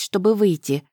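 ordinary delivery

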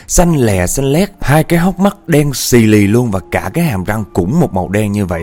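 emphatic, suspenseful storytelling; dramatic spooky storyteller; ghost story narration. A narrator's reading. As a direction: build the horror with punched descriptive words.

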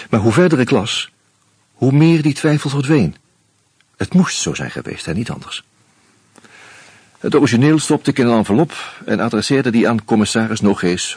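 ordinary talk, nothing marked

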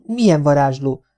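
put-on mildly scared child's question; taken aback, timid. A narrator's reading. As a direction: ask as a slightly daunted child. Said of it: "kérdezte megszeppenve Gézuka".

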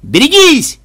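high, loud, urgent yell; warning shout. A narrator's reading. Bystander warns of a falling hospitaller, warning shout. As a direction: shout a sudden alarm.

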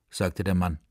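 usual speaking voice

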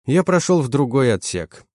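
usual speaking voice

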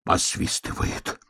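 slow, breath-only gasp; winded bear cub whisper; wheezing. A narrator's reading. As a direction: wheeze it softly, almost without voice. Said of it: "хрипя дышал медвежонок".

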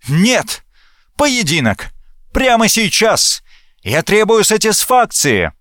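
high, insistent outbursts; angry demand. A narrator's reading. Hot-headed officer demands a duel. (гневное требование высоким голосом)

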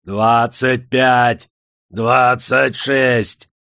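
loud, slow, drawn-out numbers; shouted count. A narrator's reading. Old master counts lashes with his staff, calling out. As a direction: stretch each count like a caller. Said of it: "взмахом посоха отсчитывал удары".

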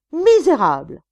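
high, loud outraged shout; furious cry. A narrator's reading. Insulted wife advancing on husband, furious shout.

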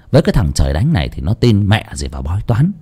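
usual speaking voice

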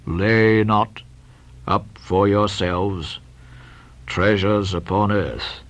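low, slow, droning scripture reading; deep, ponderous Bible mumble; mumbling old Bildad. Acted. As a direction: read it out slowly to yourself in a deep drone. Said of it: "went on mumbling to himself".

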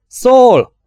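loud, high call of the name; shout. A narrator's reading. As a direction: call out to him across the flat. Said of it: "kiáltotta Chris". (hangos kiáltás)

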